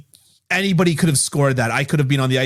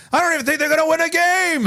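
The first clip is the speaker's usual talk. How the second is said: pitched up gloomy pundit voice; Craig Button impression. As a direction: mimic a doom-saying hockey analyst. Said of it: "start talking like Craig Button".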